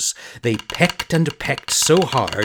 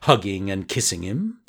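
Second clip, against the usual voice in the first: ordinary delivery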